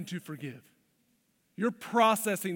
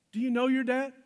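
higher and louder, pressing the point; louder, pressing question; 6 semitones higher, 4 dB louder than usual. Real, not acted. Pastor challenging the congregation, loud and pressing. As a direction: push it out to the room, demanding an answer.

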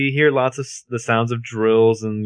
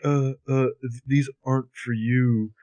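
halting, softer mock of a wooden man; slow, wooden imitation; 4 dB quieter than usual. Host mocking how sluggish the character has become, slow and wooden. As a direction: stumble through it slowly, like a dazed dullard. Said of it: "like, wooden".

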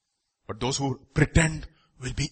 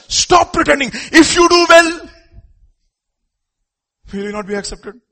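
voice of God; 10 semitones higher, 8 dB louder than usual. Retold or imitated. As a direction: command them as the Lord. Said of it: "Lord says".

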